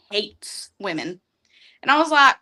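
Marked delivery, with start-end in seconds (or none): 0.1-1.2 slow, softer deadpan; understated hates women; dry quiet punchline. Acted. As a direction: let it drop flat and slow. Dramatic punchline about snake.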